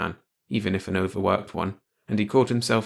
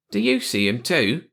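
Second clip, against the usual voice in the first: ordinary delivery, nothing marked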